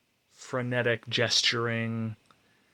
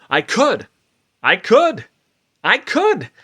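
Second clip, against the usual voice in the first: each "I could" higher and louder; building elation; 11 semitones higher, 14 dB louder than usual. Acted. Manic speaker whipping herself up, rising excitement. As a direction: climb with every repeat toward a peak.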